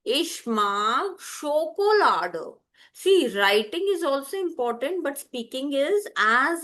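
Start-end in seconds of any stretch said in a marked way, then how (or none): 0.0-2.6 measured, each word set apart for learners; slow model to repeat. Shown as a demonstration. Teacher says "say it after me", modelling slowly. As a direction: draw out "Ich mag Schokolade" slowly. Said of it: "Say it after me".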